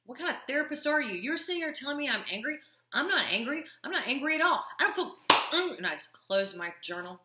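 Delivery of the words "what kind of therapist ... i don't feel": imitating her own huffy protest, high and fast; replaying her indignant rant at the therapist, pitched high; indignant outburst. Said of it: "I was like".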